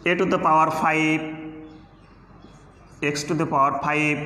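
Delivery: slow, careful "to the power five"; teacher pronouncing slowly for the class; slow demo. Shown as a demonstration. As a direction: model it slowly for students. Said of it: "five থাকলে কি?".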